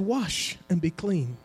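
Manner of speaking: soft, slow, gentle whisper-like command; hushed, slow divine murmur; still small voice. Retold or imitated. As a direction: say it like a quiet inner voice. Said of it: "small voice".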